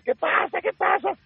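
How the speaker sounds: imitating a worried boss shouting down; alarmed producer. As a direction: producer calling down in alarm, mock high voice. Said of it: "El productor empezó a decir".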